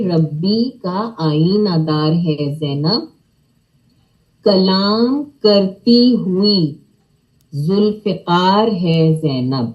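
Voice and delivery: slow measured line, throaty ق in ذوالفقار; slow model recitation. Shown as a demonstration. Teacher reciting for children, slow and measured. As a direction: recite slowly and clearly as a model. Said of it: "ق یعنی حلق کی آواز سے پڑھیں گے".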